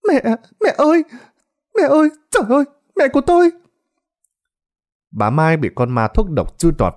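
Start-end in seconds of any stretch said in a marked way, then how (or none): 0.1-3.5 piercing high wail for mother; screaming in terror. Acted. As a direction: shriek out in horror. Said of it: "la lên thất thanh".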